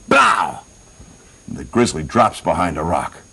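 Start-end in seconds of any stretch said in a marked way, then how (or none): 0.1-0.6 sudden high-pitched mouthed bang; one sharp cartoon gun blast; gunshot imitation. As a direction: fire off a rifle shot with the voice. Imitated rifle shot.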